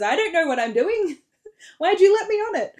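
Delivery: high, loud laughing line; laughing Morrigan. Retold or imitated. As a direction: laugh through the words. Morrigan laughing in retold scene.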